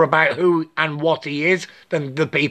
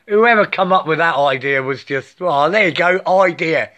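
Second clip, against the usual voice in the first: normal talking voice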